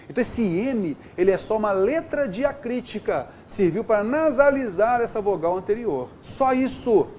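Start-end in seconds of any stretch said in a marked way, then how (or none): none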